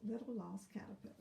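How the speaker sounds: fast hushed whisper; quick whisper. Acted. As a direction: rush it out in a whisper. Whispered title repeat.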